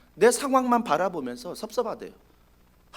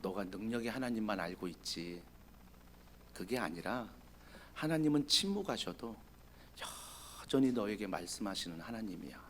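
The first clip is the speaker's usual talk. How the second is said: quieter, gentle scolding; soft rebuke; 10 semitones lower, 16 dB quieter than usual. Acted. God gently rebuking Elijah for not listening. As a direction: correct him tenderly.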